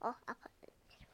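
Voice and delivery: fast breathy whisper. Real, not acted. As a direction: whisper it quickly to yourself. Child murmurs while playing.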